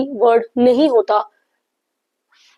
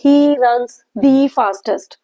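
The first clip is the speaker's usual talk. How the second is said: each word laid out slowly for students; slow example reading; much slower than usual. Shown as a demonstration. Teacher reading an example sentence slowly to students. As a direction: read it out slowly, like dictation. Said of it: "teacher reading an example slowly".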